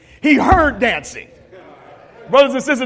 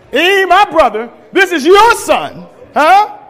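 louder and higher, resentful complaint to his father; angry older brother; 6 semitones higher, 6 dB louder than usual. Acted. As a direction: throw it at the father, bitter and loud. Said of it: "now watch what he says".

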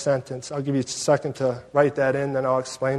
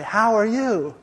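higher, rising cheery hello; big-smile greeting; 6 semitones higher, slower than usual. Retold or imitated. Huge seatmate greets the reluctant narrator, exaggeratedly friendly. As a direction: imitate an over-friendly seatmate with a grin. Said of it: "he's got this big smile".

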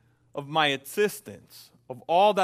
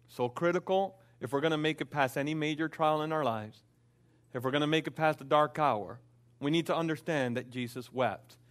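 ordinary talk, nothing marked

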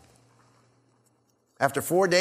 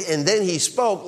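ordinary talk

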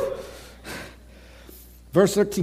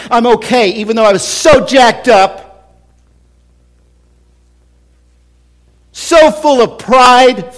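rising preaching; 6 semitones higher, 6 dB louder than usual. Acted. Preaching at full force.

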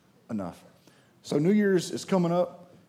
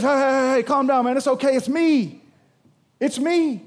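louder, higher call to settle them; Jesus calming them; 7 semitones higher, 7 dB louder than usual. Acted. Jesus calls to his scared disciples: raised, soothing call. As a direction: call out over the storm to reassure.

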